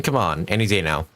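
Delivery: ordinary talk, nothing marked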